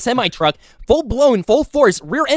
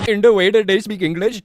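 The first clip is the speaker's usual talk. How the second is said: mocking echo in an imitated Indian accent; mimicked accent. Host parroting the claim about English, mock Indian accent.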